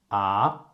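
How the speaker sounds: "ah" gliding steadily upward; rising tone. Shown as a demonstration. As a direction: lift "ah" like a surprised question. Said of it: "it goes up all the time".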